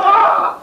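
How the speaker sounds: high, sudden alarmed yelp; startled yelp. Acted. Bennett seeing Barry fall, high startled yelp. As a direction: cry out in alarm.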